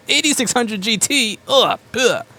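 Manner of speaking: high, gagging toilet voice retching; a toilet heaving up the parts in a high gag. Retold or imitated. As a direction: gag it back up like a clogged toilet. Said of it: "the toilet's gonna puke it back up at you and go".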